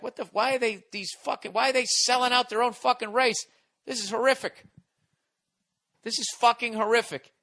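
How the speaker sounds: mock disbelief at the act; outraged viewers.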